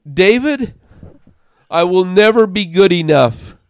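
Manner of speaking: loud, high despairing cry; imitating a wife who feels she has failed; sad, defeated. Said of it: "her sad, defeated face".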